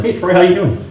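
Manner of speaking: high, chirpy mock hello; breezy phone greeting. Agent greeting the rival husband on the phone, mock-breezy. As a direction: greet a rival with put-on friendliness.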